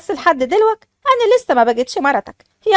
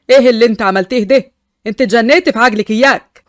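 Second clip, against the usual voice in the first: louder, lower, furious husband; 1 angry man; 4 semitones lower, 4 dB louder than usual. Acted. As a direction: snap at her after she fires. Fares angry after the gunshot. (زوج غاضب بصوت أعلى وأخفض)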